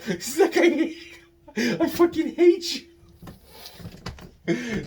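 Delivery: high-pitched helpless laughter through the words; real laughing fit. Real, not acted. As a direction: squeeze it out while cracking up. Breaks up laughing mid-story.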